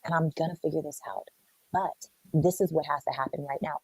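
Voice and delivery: soft, reassuring murmur; calm her fears gently; soothing partner. Retold or imitated. Loving man comforting a scared partner: soft, reassuring. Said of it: "true leadership is able to see".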